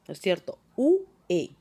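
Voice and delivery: ordinary talk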